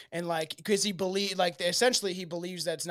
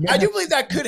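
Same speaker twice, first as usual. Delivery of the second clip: laughing; 9 semitones higher, 9 dB louder, faster than usual. Real, not acted. Laughing after the joke.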